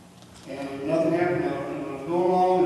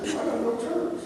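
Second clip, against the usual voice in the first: tearful explanation; 8 semitones higher, 3 dB quieter, faster than usual. Retold or imitated. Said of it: "I said".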